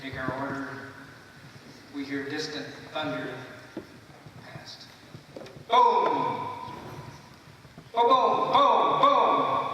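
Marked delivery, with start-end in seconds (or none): 5.7-9.7 loud, high booms like artillery; booming cannon sounds. Acted. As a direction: shout out the guns firing. Imitating distant artillery.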